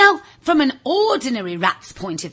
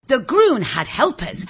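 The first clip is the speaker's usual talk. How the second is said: plain reading voice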